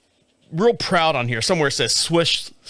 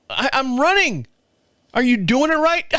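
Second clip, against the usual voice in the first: high, mock-exasperated question; incredulous retort; 7 semitones higher than usual. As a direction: act stunned she'd ask. Retelling wife's doubt, incredulous.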